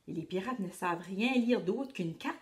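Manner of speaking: plain reading voice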